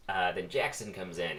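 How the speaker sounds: plain reading voice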